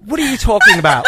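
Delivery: mock-offended fake British accent. Shown as a demonstration. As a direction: protest in a hammed-up British voice. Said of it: "Can you just do your British accent one more time?".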